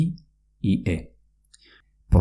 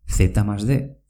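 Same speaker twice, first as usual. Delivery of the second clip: ordinary talk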